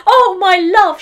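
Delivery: a high, loud cry of joy; overjoyed cry. Acted. The wife greets her husband, high and overjoyed. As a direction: cry out in delight.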